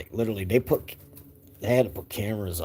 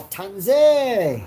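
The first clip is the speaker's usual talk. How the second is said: high, loud call across the yard; big calling-out yell; demonstrated yell; 10 semitones higher, 9 dB louder than usual. Shown as a demonstration. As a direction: holler the name out long and high.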